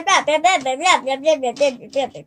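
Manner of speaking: ordinary talk, nothing marked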